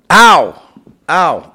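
sharp yelps of pain; pained cry. Real, not acted. Sudden back injury, crying out.

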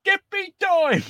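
high hammed-up Cockney sweep shout; rally the lads like a cartoon Cockney; mock-Cockney musical cry; Mary Poppins chimney sweep. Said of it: "You need to be in Mary Poppins".

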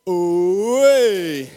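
very loud, high rising ooh; big ooh. Shown as a demonstration. Preacher showing the crowd the ooh, very loud. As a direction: belt out the ooh.

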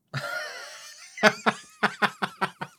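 a long, high burst of laughter. Real, not acted. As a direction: laugh hard at a sudden memory. Remembers funny moment.

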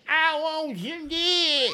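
high, slow, whining granny; old lady voice. Retold or imitated. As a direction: wail it slowly, high and old. Imitating old woman.

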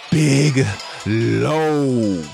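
normal talking voice

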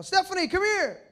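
loud, high holler upstairs; calling out. Retold or imitated. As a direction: call a sister down from upstairs. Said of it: "I'd call".

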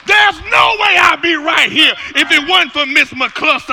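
loud, high shouted preaching over the music; a pastor shouting the message; full-force preaching. Acted. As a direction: preach it hard to the whole church.

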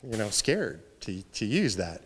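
sheepish, low and soft; halting admission, voice sinking with shame; embarrassed. Acted. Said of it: "the embarrassment of knowing".

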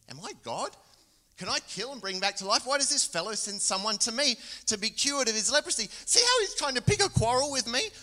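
building from puzzled to a loud, high indignant cry; rising panic of an offended king, climbing to a shout; dramatic outburst. Acted. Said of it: "given to a bit of drama".

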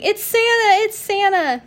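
high, loud mock squeal of delight; excited child's scream. Host voices the little girl greeting Santa, excited mock scream. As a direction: imitate a thrilled little kid screaming. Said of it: "she's screaming".

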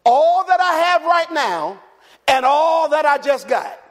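loud, high and slow, building; preacher voicing the rich man's plan, loud; full-force preaching.

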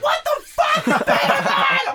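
high laughing shout; a laughing, squealing outburst; laughing mom. Retold or imitated. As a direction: shriek it while laughing. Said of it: "She's like".

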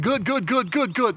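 high, rapid-fire string of goods; repeated approval. Acted. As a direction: chant each word identically, pitched well up. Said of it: "He's been saying".

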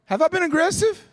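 high-pitched mock protest to the congregation; mock-indignant question. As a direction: play the wronged man, pitch shooting up. Jokes back at wife's complaint.

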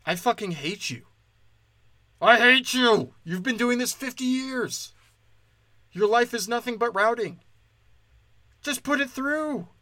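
high-pitched, fed-up tirade; exasperated rant. Acted. Customer fed up with a pointless charge, exasperated.

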